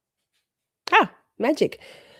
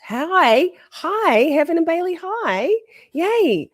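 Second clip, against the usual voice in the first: much higher, delighted hi; excited greeting; 12 semitones higher, 3 dB louder, slower than usual. Real, not acted. Streamer greeting Heaven and Bailey on stream, excited. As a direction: squeal the greeting up high.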